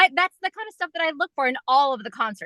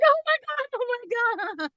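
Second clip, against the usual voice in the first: much higher, giddy gasping squeal; starstruck; 11 semitones higher, 4 dB quieter than usual. Retold or imitated. Fan as the boys come to sing to her, giddy squeal. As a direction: gush in disbelief. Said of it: "And I'm like".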